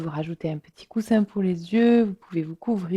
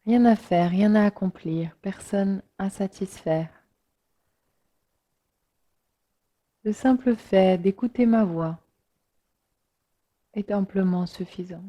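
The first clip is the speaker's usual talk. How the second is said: unhurried meditation voice with long pauses; guided relaxation. Real, not acted. Yoga teacher inviting listeners to let the body sink, guided relaxation. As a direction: guide listeners gently into rest, letting phrases settle.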